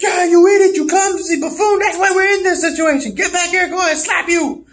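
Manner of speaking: very high-pitched, squawking tirade; hammed-up angry berating; mock scolding. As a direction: rant in a squeaky fury. Acted scolding during fishing mishap.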